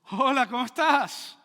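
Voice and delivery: hola alzando la voz, despacio; saludo más fuerte. Retold or imitated. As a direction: repite el saludo más alto y marcado. (saludo más alto y lento)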